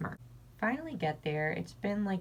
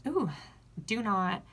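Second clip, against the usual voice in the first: ordinary talk, nothing marked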